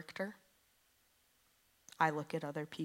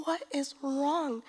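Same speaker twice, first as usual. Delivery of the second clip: worried mom; 9 semitones higher, 7 dB louder, slower than usual. Retold or imitated. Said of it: "It's like".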